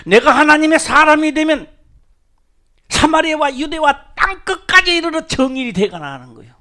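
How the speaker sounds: proclaiming. Real, not acted. The preacher proclaims to the congregation at full force.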